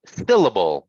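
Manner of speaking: "Syllable" with SYL punched, then falling; first syllable stressed. Shown as a demonstration. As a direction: hit the start of "Syllable" hard. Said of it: "The first syllable of syllable is stressed".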